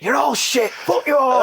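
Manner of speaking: high-pitched imitated shout; retold touchline abuse; mock manager's rant. Imitating manager yelling at players.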